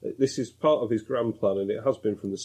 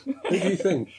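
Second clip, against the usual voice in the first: laughing; 9 semitones higher than usual. Real, not acted. Laughing banter.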